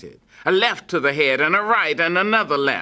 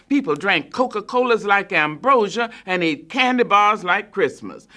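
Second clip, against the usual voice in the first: ordinary delivery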